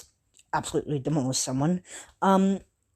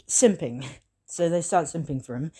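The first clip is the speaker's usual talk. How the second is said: usual speaking voice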